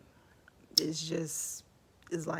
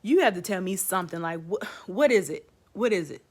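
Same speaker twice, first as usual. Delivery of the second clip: fast, louder pressing for an answer; insistent demand; 3 semitones higher, 7 dB louder, faster than usual. Retold or imitated. Patient pressing the nurse for news, loud and urgent. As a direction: push the nurse to tell you, loud and quick.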